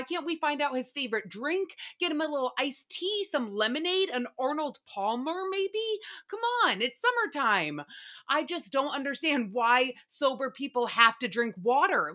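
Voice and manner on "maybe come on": pleading. Real, not acted. Pleading rhetorically.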